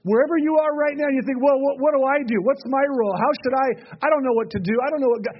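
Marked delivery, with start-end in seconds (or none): 1.4-5.4 higher, mildly flustered questioning; flustered self-doubt. Acted. A listener doubting their role, mildly flustered. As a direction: act out someone unsure what to do.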